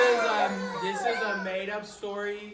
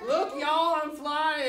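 higher, louder excited rooster; 7 semitones higher, 8 dB louder, slower than usual. Acted. Rooster shouting from the sky, high and loud. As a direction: crow it out like a proud rooster. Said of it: "He says".

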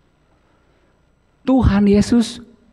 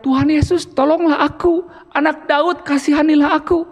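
higher, pleading mock cry for help; 6 semitones higher than usual. Preacher acts the blind man calling to Jesus, higher mock cry. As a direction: call out to Jesus like a blind beggar, pitched up. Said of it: "Kalau berteriak".

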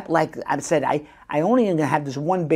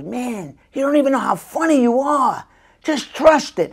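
enthusiastic praise; 8 semitones higher than usual. Retold or imitated. Showrunner praising after monologue.

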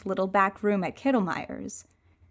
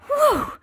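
high exhale; 4 dB louder than usual. Acted. Overwhelmed relief.

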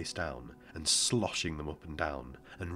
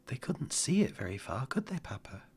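put-on small, higher child voice; boy's voice; 8 semitones higher than usual. A narrator's reading. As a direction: voice the boy, light and young.

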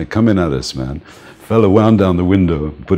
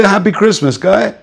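much higher, jaunty sendoff; cheerful farewell; 5 dB louder than usual. Retold or imitated. Narrator dismissing the soldier, high jaunty holiday greeting. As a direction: wave them off cheerily. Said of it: "I say".

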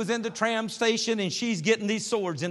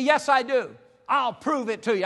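insistent; 6 semitones higher, 5 dB louder than usual. Retold or imitated. Drunk insists he owns Bible.

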